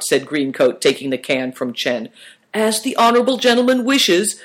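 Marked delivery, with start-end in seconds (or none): 2.5-4.3 high-pitched, deferential compliance; submissive. A narrator's reading. Chen yielding to the honorable gentleman, submissively. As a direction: give way politely, voice pitched up. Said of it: "said Chen submissively".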